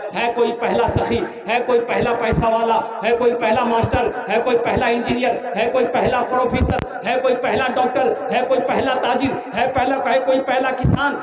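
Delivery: rising, insistent appeal to the crowd; fundraising call-out. Real, not acted. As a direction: call out to the donors, building. (चंदे की पुकार)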